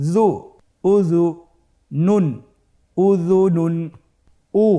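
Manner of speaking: a teacher spelling for the class; syllable by syllable. Shown as a demonstration. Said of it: "ভেঙে ভেঙে পড়তে হয়".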